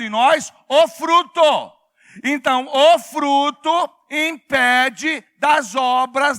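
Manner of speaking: loud, high, slow emphatic proclamation; impassioned shouting from the pulpit; full-force preaching. Real, not acted. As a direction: hammer out the key words syllable by syllable.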